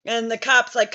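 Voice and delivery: usual speaking voice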